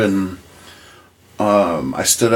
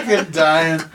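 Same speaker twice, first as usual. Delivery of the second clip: normal talking voice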